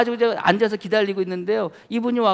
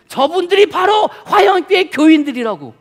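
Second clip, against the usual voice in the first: building excitement; 8 semitones higher, 8 dB louder than usual. Retold or imitated.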